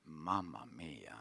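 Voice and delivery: hushed, slow unvoiced aside, half amused; whispered mamma mia. Preacher tells congregation about his determined wife, whispered. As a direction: breathe the words out in a knowing hush. Wife's will, rueful exclamation.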